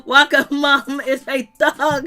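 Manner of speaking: slow, loud words breaking into laughter; laughing. Real, not acted. Host joking about Waka's mom, laughing. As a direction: say it while cracking up. Cracking up at own remark.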